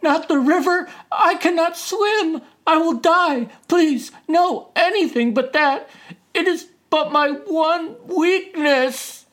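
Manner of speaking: high, loud frantic begging; panicked pleading. Acted. Turtle pretends to fear the river, frantic pleading. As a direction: plead wildly as if terrified. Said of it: "screamed Turtle".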